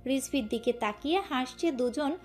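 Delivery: usual speaking voice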